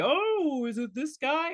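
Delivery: high-pitched mock wonder; put-on high "ooh, maybe" voice; mock excited guess. Voicing a viewer's wrong guess.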